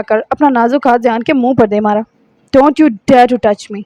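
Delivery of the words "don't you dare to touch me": ordinary delivery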